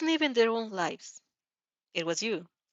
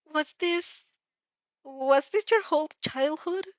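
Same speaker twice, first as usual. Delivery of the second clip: put-on higher, hesitant girl's voice with a rising question; uncertain Uraraka; 7 semitones higher, slower than usual. Uraraka unsure, asking Izuku about his childhood, hesitant and higher. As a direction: ask it unsure, voicing a young girl. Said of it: "Uraraka uncertainly asks".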